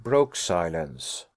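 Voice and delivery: ordinary delivery, nothing marked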